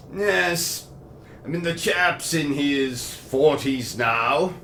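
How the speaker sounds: put-on posh British accent telling a crude tale; a pompous host chatting to Bertram.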